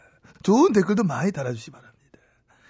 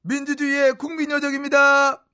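fairy announcement; 7 semitones higher, 3 dB louder than usual. Acted.

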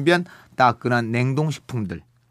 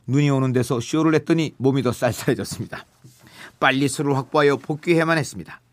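ordinary talk

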